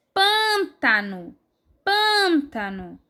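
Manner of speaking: PÂN drawn out long in pântano, rest falling away; stressed first syllable. Shown as a demonstration. Teacher showing pupils the strong syllable in pântano, first syllable stressed. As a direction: say pântano slowly, leaning hard on "pân". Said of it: "a sílaba mais forte".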